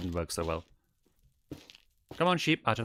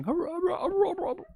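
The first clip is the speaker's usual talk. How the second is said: very high yappy woofs; imitated small dog; little dog barks; faster than usual. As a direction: yap like a tiny dog. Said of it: "a little dog going".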